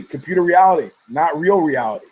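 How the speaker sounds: ordinary talk, nothing marked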